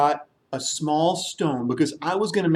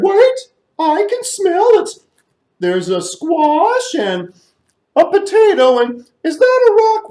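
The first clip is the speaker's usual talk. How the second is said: high, loud sniffing excitement; excited elephant; 11 semitones higher, 7 dB louder than usual. Acted. Elephant smelling the soup, loud and eager.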